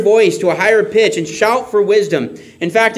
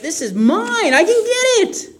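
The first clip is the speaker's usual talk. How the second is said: giddy, much higher voice over the treasure, with a laugh; excited; 14 semitones higher than usual. Pretend. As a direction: gloat with delight at a fortune. Imagining finding hidden treasure.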